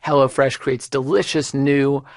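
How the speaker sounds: ordinary talk, nothing marked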